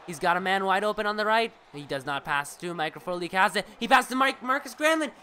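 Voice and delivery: brisk sportscaster call, rising on the shots; live play-by-play. Acted. Streamer calling the hockey game for his viewers, lively play-by-play.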